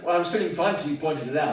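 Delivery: dry put-down of the friend; wry retort. Retold or imitated. Retold wry reply.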